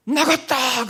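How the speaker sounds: sudden high yell of release; a triumphant cry; shouted exclamation. Retold or imitated. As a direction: shout it out like something just left you. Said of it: "그러는 거예요".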